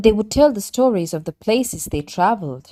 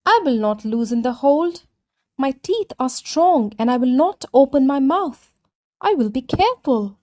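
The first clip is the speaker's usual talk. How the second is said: confident tortoise; 5 semitones higher than usual. Acted. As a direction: reassure them as the cocky tortoise.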